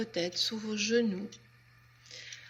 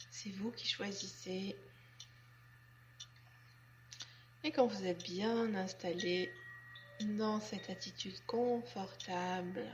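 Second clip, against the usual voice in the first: guided relaxation; 5 dB quieter than usual. Acted. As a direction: guide them gently and unhurriedly into letting go. (voix douce et lente de relaxation guidée)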